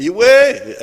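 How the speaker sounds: high, loud long-distance call; calling shout. Acted. Man recalling how he called across Ntungamo, loud shout. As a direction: shout it out to people far off. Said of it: "near Kajuriga and shout".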